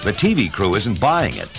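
plain reading voice

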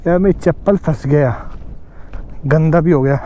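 ordinary talk, nothing marked

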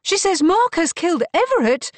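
high, loud outburst; shocked cry. A narrator's reading. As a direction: cry out the accusation in disbelief. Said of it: "cried Kate".

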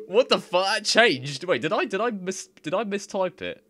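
high, loud shocked outburst; stunned and baffled. Real, not acted. Shocked translation changed.

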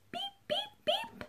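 very high, soft little "beep, beep, beep"; high tiny horn beep. Acted. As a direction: toot "beep" like a small truck horn. Blue's horn in picture book.

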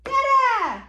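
high, loud Dada sliding down; big playful singsong cheer; exclaimed refrain. Acted. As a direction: belt it out high for baby. Parent cheers book's refrain to child.

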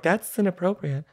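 high-pitched mock disapproval; imitated offended audience. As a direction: scold like an offended listener.

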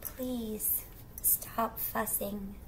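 ordinary delivery

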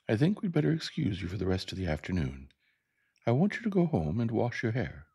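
lilting teacher giving careful instructions; Miss Caroline, tactful teacher. Acted. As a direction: politely send the pupil home.

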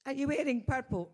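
a bit hoarse, throat catching; husky with a frog in the throat. Real, not acted. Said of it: "I've got a frog in my throat".

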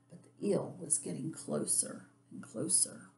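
very soft, near-whispered narration; suspenseful storybook whisper; hushed suspense. A narrator's reading. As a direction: drop to a hush as danger creeps up. Suspense as the eel closes in.

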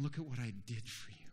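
soft, low, quick phrase; hushed appeal. Acted. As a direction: say it gently, almost to yourself. True vine speaking tenderly to branch.

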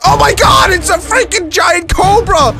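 high startled yell, then a laugh; startled shout. Real, not acted. Spotting a giant cobra, startled high shout. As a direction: jump at the snake and laugh it off.

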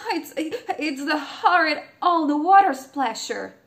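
queen exclaims in disgusted disbelief; exclaiming queen. A narrator's reading. Queen exclaims at the frog at supper. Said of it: "exclaimed the queen".

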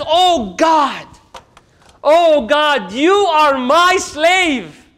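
high, loud, slow ecstatic cry to God; overjoyed cry. Retold or imitated. A man who found his camel, crying out with joy.

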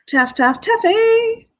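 singsong call leaping high on the name; high, playful name call; calling to Taffy. Real, not acted. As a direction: call Taffy, stretching the last word up high.

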